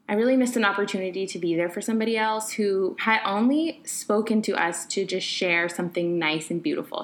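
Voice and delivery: ordinary talk, nothing marked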